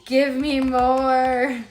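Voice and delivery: loud, slow, drawn-out demand; hungry stomach voice. Acted. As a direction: groan it out like a ravenous stomach. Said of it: "my stomach was like".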